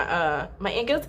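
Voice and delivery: normal talking voice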